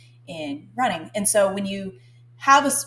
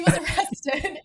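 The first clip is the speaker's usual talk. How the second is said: retry the line and burst out laughing; failing to keep straight-faced; 9 semitones higher than usual. Real, not acted. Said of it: "can't even say this with a straight face".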